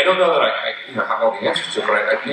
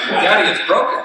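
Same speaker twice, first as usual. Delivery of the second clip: put-on little boy voice; 7 semitones higher, 4 dB louder than usual. Father voices his small son.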